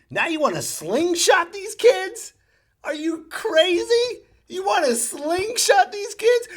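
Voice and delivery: high, loud, drawn-out outrage; outraged disbelief. Retold or imitated. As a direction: shout it at the announcer. Outraged at announcer order.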